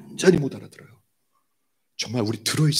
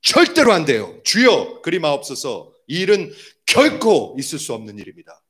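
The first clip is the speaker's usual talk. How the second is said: scolding protest. Retold or imitated. Peter rebuking Jesus over the cross, scolding protest. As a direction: plead and scold, punching 절대로 and 결코. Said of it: "이렇게 야단을 쳐요".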